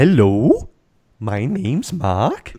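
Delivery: slow, mock Finnish; lilting, badly faked Finnish accent. Shown as a demonstration. Co-host posing as Mark introducing himself, mock Finnish accent. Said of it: "in a Finnish accent".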